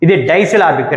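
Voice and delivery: ordinary talk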